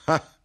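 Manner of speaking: single high, loud "ah!" laugh; knife grinder's short high laugh; acted burst of laughter. A narrator's reading. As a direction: let out one sharp laugh. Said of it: "e lui rise".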